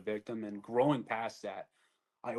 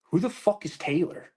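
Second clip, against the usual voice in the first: higher-pitched, incredulous question; retold bafflement; 6 semitones higher, 4 dB louder than usual. Soldier answering the clinic lady's question about Taylor, baffled. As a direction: replay a baffled comeback. Retelling his baffled reply.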